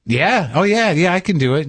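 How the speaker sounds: high, eager and quick to agree; overeager yes. Retold or imitated. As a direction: fake confidence, hurried yes. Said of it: "you're like".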